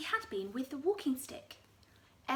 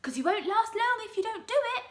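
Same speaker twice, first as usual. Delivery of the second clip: high, fast gloating cry; grinning Mister Twit; 11 semitones higher, 9 dB louder, faster than usual. Acted. Husband scaring his wife, high fast gloating. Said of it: "cried Mister Twit, giving her another grisly grin".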